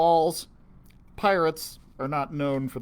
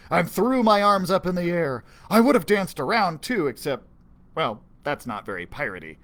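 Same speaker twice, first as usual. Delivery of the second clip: ordinary delivery, nothing marked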